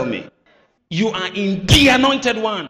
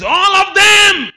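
drawn-out, high, loud shout; preacher shouting scripture to the congregation; preaching shout; 12 dB louder, slower than usual. Acted. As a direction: stretch it out and shout. Preaching emphasis, stretched shout.

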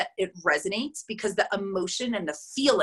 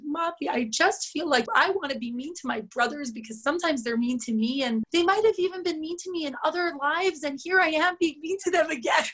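daughter; 6 semitones higher than usual. Retold or imitated. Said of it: "She's like".

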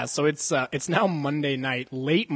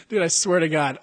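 normal talking voice

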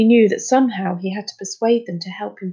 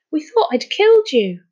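high-pitched, louder groan of guilt; 7 semitones higher, 5 dB louder than usual. A narrator's reading. Charlie to the boy he thought he'd killed, groaning. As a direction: groan it out, appalled. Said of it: "groaned Charlie".

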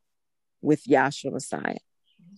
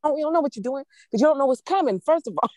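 higher and faster, pressing the point home; emphatic retort; 9 semitones higher, faster than usual. Real, not acted. Preacher cutting off a cocky believer, emphatic. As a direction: cut them off, you don't know.

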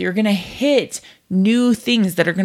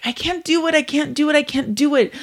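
put-on higher, whiny kid voice repeating it; little kid whining; 8 semitones higher, faster than usual. Little kid stuck on something, whining higher. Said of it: "they're like".

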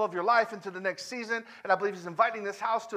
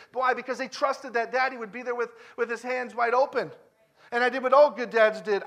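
usual speaking voice

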